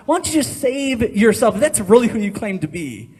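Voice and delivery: mocking shout. Preacher voicing guards jeering at the crucified man, loud mocking shout. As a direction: taunt him like a jeering crowd. Mocking crowd voiced in sermon.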